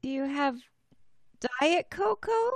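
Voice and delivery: high, loud cheeky request; high squeaky character voice, playful; high Winley voice. Acted. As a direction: ask sweetly in a tiny high voice.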